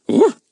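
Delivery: high, loud happy woof; pleased dog bark. A narrator's reading. Buster joins in the thanks, happy bark.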